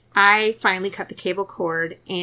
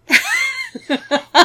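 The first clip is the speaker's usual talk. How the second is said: high laugh; 8 dB louder than usual. Real, not acted. Laughing at lame meds.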